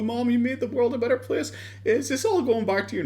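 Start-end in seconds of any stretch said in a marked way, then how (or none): 0.0-1.5 high mock-earnest son voice; mocking impression in a high voice; mocking tribute. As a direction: imitate a gushing son, pitched way up. Mocks Boogie addressing dead mom.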